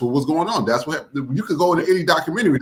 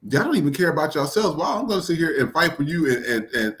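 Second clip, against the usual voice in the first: usual speaking voice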